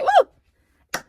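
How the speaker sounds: high, loud whooping cheer; imitating his excited holler; mock cheer. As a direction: let out a goofy church-boy whoop. Husband cheering after being smacked.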